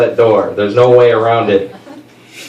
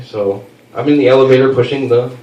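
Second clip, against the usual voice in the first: usual speaking voice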